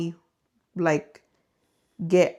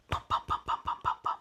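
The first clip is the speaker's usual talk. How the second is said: whispered footstep thuds; 10 dB quieter, faster than usual. Retold or imitated. Imitating footsteps heard at night.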